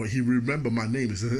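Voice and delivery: usual speaking voice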